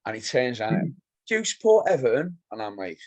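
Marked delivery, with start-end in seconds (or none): 1.3-2.4 higher-pitched imitated question; put-on high questioning voice; challenging fan. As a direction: mimic a stranger's challenge, rising at the end. Voices the fan turning round.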